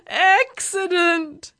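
long, high, loud moan. A narrator's reading. Nervous driver moans all down the road, moaning. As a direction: wail it slowly in dread. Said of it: "he moaned all the way".

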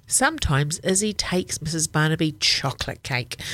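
ordinary delivery

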